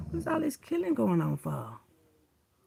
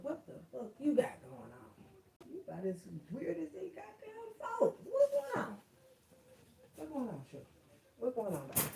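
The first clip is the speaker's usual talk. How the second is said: much higher, soft cooing at the dogs; pet talk; 6 semitones higher, 9 dB quieter than usual. Real, not acted. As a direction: coo like greeting your pups.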